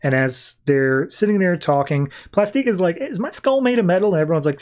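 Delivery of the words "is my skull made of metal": much higher, dopey question; lightly voiced airhead; dim-witted voice. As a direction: play her as clueless and spacey. Plastique voiced as dim character.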